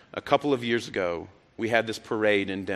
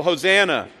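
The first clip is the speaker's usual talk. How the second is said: raised, louder shout of praise; retold crowd yell; 5 semitones higher, 5 dB louder than usual. Preacher voicing townspeople calling up the road, mild yell. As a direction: call up the hillside to pilgrims. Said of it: "They would yell".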